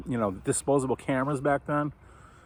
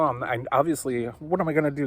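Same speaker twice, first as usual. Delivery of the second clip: usual speaking voice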